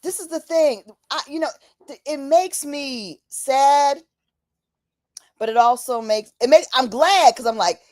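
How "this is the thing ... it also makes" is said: ordinary delivery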